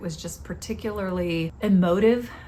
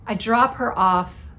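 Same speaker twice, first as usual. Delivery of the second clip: normal talking voice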